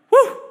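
high, loud relieved exhale; relieved whew. Retold or imitated. Husband's relieved whew as they head to the hospital, high and loud. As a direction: blow out the tension. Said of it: "I'm like".

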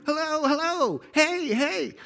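high, drawn-out hollered calls; kept hollering. Retold or imitated. Boy bellowing from the top of the mountain, hollering. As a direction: holler over and over to hear the echo. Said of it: "I just kept hollering".

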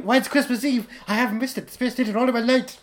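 put-on excited old Scrooge. Scrooge figure shouting joyfully.

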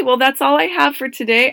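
ordinary talk, nothing marked

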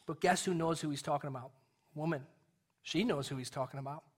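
usual speaking voice

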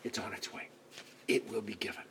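soft whispered promise; hushed, secret reassurance. Retold or imitated. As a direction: breathe it into her ear. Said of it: "whispers in her ear".

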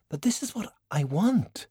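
a lightly voiced young woman, pressing her case; insisting to an older mentor; Valkyrie's earnest voice. Young sorcerer pleads with China, earnest.

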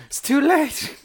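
high, hammy soap-opera wail; melodramatic cry. Pretend. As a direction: cry it out like a telenovela. Mock melodramatic reply, laughing.

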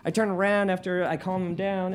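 ordinary talk, nothing marked

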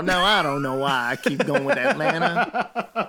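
playful imitation of a Southern drawl. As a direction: copy his Southern drawl. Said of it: "with the Southern drawl".

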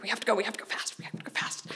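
whispered, hurried plea; urgent whisper. Retold or imitated. Nervous teen urging the taxi driver, whispered. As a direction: hiss it at the driver in a panic.

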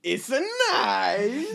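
high, slow, hammed-up Borat accent. Friends mocking people who still do Borat, exaggerated Borat accent. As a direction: do a drawn-out bad Borat accent. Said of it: "still do the Borat accent".

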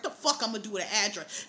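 ordinary talk

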